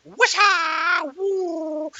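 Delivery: high, loud theatrical magic whoosh; mock spell sound. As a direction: ham it up like a stage wizard. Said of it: "I'd be like".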